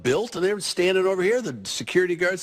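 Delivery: normal talking voice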